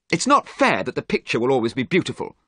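quick, high, acted outburst; upset protest. A narrator's reading. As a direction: rush it out as a heated complaint. Dorian's acted jealous outburst.

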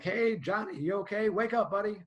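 high, loud call to wake someone; yelling to rouse. Shown as a demonstration. Medic yelling at an unconscious patient to rouse them. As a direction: holler as if to someone out cold. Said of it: "yell at them like".

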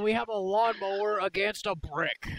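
loud, high mock arena announcer; ring announcer. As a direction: announce it to a cheering crowd. Mock announcing the robot fight.

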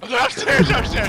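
ordinary talk

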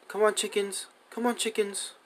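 high coaxing call to animals. Real, not acted. Player herding his chickens into a hole, high coaxing call.

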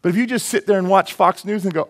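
normal talking voice

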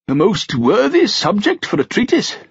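put-on high, pleased old king; delighted old king. A narrator's reading. Old king leafing through a falconry book, delighted. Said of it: "nodding in delight".

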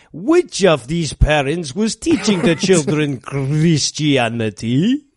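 lightly put-on foreign villain accent, V and W swapped; mock communist accent. Podcaster imitating the communist soldier's accent, mock foreign accent. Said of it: "this is not me doing a bad accent".

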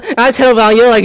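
ordinary talk

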